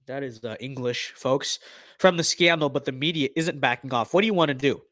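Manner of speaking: ordinary talk, nothing marked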